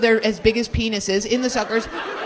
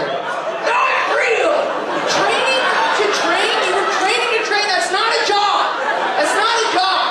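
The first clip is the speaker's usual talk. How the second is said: incredulous yelling; 9 semitones higher than usual. Real, not acted. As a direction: yell it like it's absurd. Mocking her training job answer.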